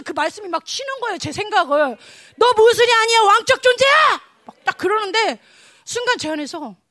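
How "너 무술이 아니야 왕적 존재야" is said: high, shouted proclamation; acted commanding divine pronouncement; emphatic declaration. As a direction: declare their true worth at full voice.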